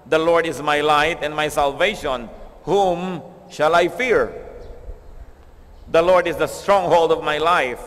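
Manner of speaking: slow, solemn psalm reading; scripture reading. Acted. Preacher reads the psalm to the congregation, slow and solemn. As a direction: draw out each line of the psalm.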